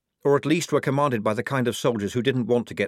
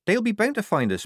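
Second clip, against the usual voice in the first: high-pitched, voiced hiss; worried young recruit, pitched high; anxious hiss; 11 semitones higher than usual. A narrator's reading. Polly hiding from patrols with the sergeant, high anxious hiss. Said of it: "Polly hissed".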